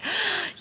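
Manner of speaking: sharp excited gasp of delight. Retold or imitated. Girl gasping in delight to her mom. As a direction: gasp like it's all too good. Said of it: "I was starting to get all like".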